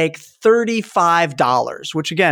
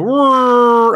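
slow, loud, higher mock engine roar; a clumsy engine noise made with the voice; bad TIE fighter roar; 7 semitones higher, 5 dB louder than usual. Shown as a demonstration. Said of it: "a really bad impersonation".

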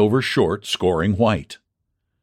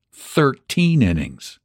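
plain reading voice

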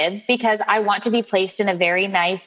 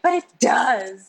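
drawn-out, slightly raised protest; mock protest; 3 semitones higher than usual. Wife retelling her objection to husband, drawn-out mock protest. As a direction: insist slowly, playing up the self-pity.

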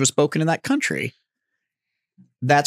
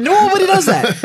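much higher, louder echo of the line; high excited echo; 6 dB louder than usual. Real, not acted. Echoing a friend's joke about expats, high and loud. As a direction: fling it back up high.

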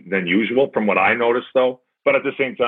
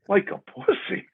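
much higher, stunned repeat of the insult; incredulous echo; 11 semitones higher, 4 dB quieter than usual. Retold or imitated. Player repeating the coach's insult, high incredulous echo. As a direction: echo it back in disbelief. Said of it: "I'm like".

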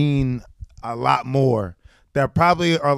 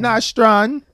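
higher, louder Nostrand as NAHstrahn; mock Jamaican Nostrand; 6 semitones higher, 4 dB louder than usual. Host teases guest's Jamaican pronunciation, louder and higher. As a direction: ham up her Jamaican Nostrand. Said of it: "I just say it very Jamaican".

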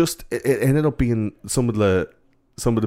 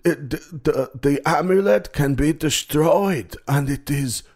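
high, slow mock German accent; hammed-up German prophecy, very drawn out; old scary German guy; 7 semitones higher, slower than usual. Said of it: "this old scary German guy".

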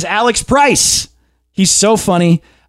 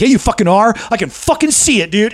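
imitated yelling; 6 semitones higher, faster than usual. Guest insisting he can see the host is a cop, mild mock yell. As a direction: mimic a heated accuser at restrained volume. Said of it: "he starts yelling".

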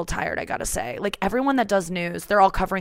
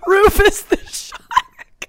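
slow, higher laughing shark voice; shark character; 13 semitones higher, 7 dB louder, much slower than usual. Pretend. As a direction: giggle through a silly shark voice. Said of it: "do a shark".

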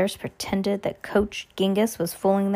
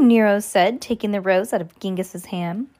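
plain reading voice

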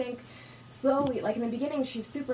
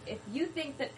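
flubbed line reading; 6 semitones higher than usual. Retold or imitated. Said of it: "I was like".

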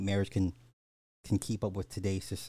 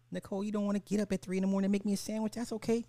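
fast high mocking voice; mock her in a high voice; 11 semitones higher, much faster than usual.

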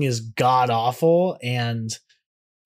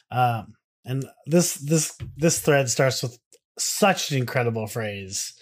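normal talking voice

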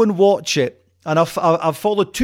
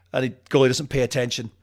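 ordinary talk, nothing marked

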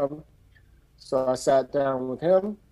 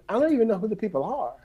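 mock baffled protest; 7 semitones higher, faster than usual. Baffled by door assignment.

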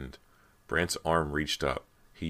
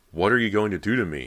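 loud, high, fast question; frightened cry; 5 semitones higher, 8 dB louder, faster than usual. A narrator's reading. Brant backed against the wall by a ghost, crying out. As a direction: cry out in panic. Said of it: "he cried".

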